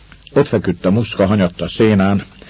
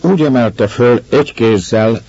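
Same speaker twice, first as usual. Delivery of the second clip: usual speaking voice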